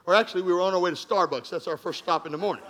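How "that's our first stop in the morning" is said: soft, chuckling aside; laughing aside. Real, not acted. Pastor joking to his congregation, softly laughing. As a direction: let a laugh through the joke.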